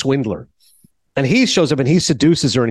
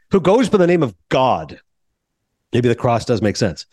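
ordinary delivery

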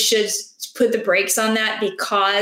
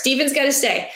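ordinary talk, nothing marked

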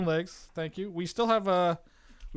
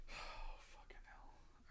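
breathy whispered swear under breath; whispered curse; 24 dB quieter, slower than usual. Real, not acted. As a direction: whisper it in dread. Dreading the task, whispered.

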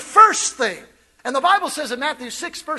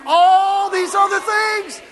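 slow, loud, higher call; slow shouted call; 5 semitones higher, 4 dB louder, slower than usual. Shown as a demonstration. Preacher leads the congregation in unison, shouting. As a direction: stretch every word. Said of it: "say it with me".

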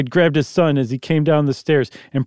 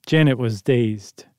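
ordinary delivery, nothing marked

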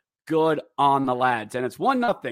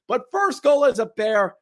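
higher, louder celebratory cheer; excited cheer; 9 semitones higher, 5 dB louder than usual. Real, not acted. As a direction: celebrate the first goal. Celebrating goal.

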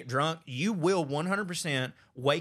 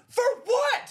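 very high, loud mock protest; retold indignant cry; 5 dB louder than usual. Punched brother protesting to his sister, indignant high cry. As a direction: squawk it in hammed-up disbelief.

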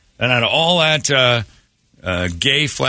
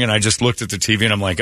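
normal talking voice

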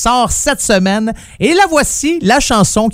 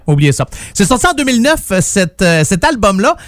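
usual speaking voice

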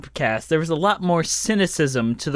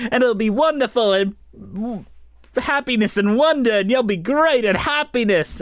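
higher, hammed-up musical theatre wonder; gushing Broadway cheer; 5 semitones higher than usual. Mocking the Broadway Doctor's promises to parents, gushing cheer. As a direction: oversell the joy with lifted, swooping pitch. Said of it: "in the new version on Broadway".

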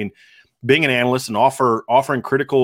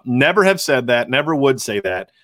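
normal talking voice

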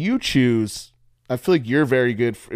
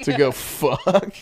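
laughing; 2 semitones higher, much slower than usual. Real, not acted. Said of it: "I knew that you knew what I was gonna say".

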